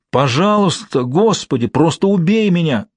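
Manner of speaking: high, desperate pleading cry; a woman crying out in pain; pained screams. A narrator's reading. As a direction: beg in agony from far off. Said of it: "Она так кричит, словно ей больно".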